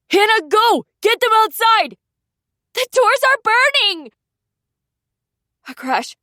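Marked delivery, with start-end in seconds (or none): 0.1-4.1 high, loud commands; urgent shouting. A narrator's reading. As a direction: yell at them to run. Sister orders escape.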